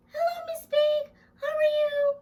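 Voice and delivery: put-on squeaky kid puppet chirping hello. Puppet Jackson greeting Miss Big, high and quick.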